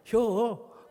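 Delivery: loud, high one-word Chungcheong reply; short Chungcheong dialect. Shown as a demonstration. Pastor answers the asker, terse Chungcheong accent. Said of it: "충청도 말이 제일 짧고 간단해요".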